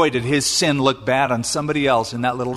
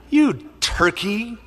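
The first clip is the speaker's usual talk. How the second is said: teasing scold; 6 semitones higher, slower than usual. Real, not acted. Preacher scolds Judah.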